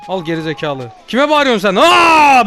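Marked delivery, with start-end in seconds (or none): 1.1-1.8 loud, high indignant challenge; yelling back. Pretend. Ghost answering the shouting old man, indignant yell. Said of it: "Ben de sana bağırıyorum". 1.8-2.4 very loud, high "aaa"; retaliatory scream. Pretend. Ghost out-screaming the old man, loud scream. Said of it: "Ben de sana bağırıyorum".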